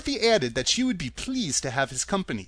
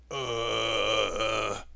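low groan from behind; the rusted man groans again, deep groan; 6 semitones lower, 3 dB quieter than usual. Acted. Tin Woodman groans unseen behind them, low groan. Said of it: "another groan reached their ears".

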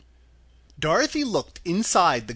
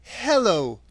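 put-on higher girl's voice, reciting a magic word; deliberate spell word, pitched up; Dorothy; 6 semitones higher, 4 dB louder than usual. A narrator's reading. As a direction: chant it higher, falling away at the end. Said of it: "Dorothy went on".

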